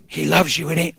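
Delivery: ordinary talk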